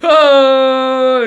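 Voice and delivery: long, loud high hoot; mock "oh" howl. Host exaggerating the man's cry, endless high hoot. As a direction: hold one high note in exaggerated mimicry.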